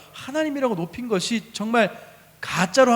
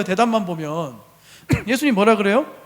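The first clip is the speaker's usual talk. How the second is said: usual speaking voice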